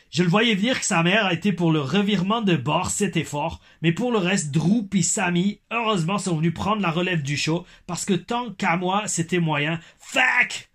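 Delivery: high, hammed-up Québécois accent. Reading a fan's wrestling verdict in mock Québécois accent. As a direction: push the put-on Québécois accent up to a final loud curse. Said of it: "Mon accent québécois passe du belge".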